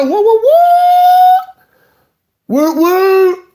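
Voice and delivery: loud, high, sung woo calls; excited whoops. Real, not acted. The host greeting a viewer who just joined the stream with loud, high, sung whoops.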